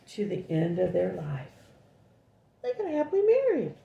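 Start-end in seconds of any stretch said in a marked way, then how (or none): 2.6-3.7 excited. Real, not acted. Excited aside to child.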